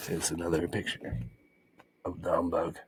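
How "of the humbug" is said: ordinary delivery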